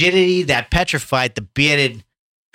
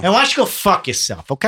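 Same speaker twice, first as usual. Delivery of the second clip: hammed-up tough-guy snap, higher; mock angry; 8 semitones higher, 3 dB louder, faster than usual. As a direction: tell him off like a Boston townie. Mock quarrel over accent.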